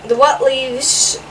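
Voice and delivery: plain reading voice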